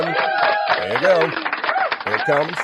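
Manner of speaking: slow, high, loud build-up; drawn-out hype. Acted. Host hyping chat love, slow and loud. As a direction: stretch every word like an announcer.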